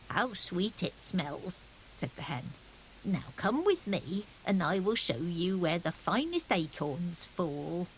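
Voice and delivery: put-on slow, gentle old hen; lightly voiced hen. Speckledy Hen sniffing the cake, slow and gentle. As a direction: coo over the cake slowly.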